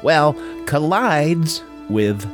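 ordinary delivery

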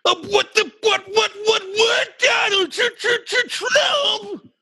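high, stammering cry of disbelief; shocked shout. Acted. Old man shocked to hear Trump won, stammering high. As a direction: sputter out the name in high shock.

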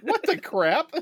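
very high, loud outraged cry; mock outrage. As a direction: blurt it in disbelief. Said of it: "it's like".

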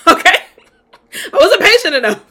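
high, loud, laughing self-mockery; laughing admission. Real, not acted. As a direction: laugh through owning up to your own fault. Laughs at own past impatience.

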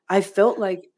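ordinary delivery

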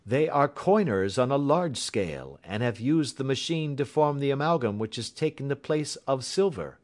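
a hint of a confident sleuth; explaining detective. A narrator's reading. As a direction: lay out the facts with assurance. Voices Holmes.

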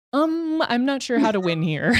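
a touch of exasperated disbelief; helpless confusion. Retold or imitated. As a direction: sound lost, unsure how to win. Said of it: "I'm like".

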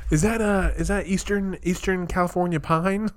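mock connoisseur. Mocking syrup connoisseur guessing origin.

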